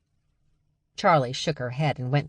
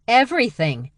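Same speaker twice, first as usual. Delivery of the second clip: raised, emphatic answer; in unison; 8 semitones higher, 6 dB louder than usual. Acted. Sisters answering Britta together, emphatic. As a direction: declare it together. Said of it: "the sisters said in unison".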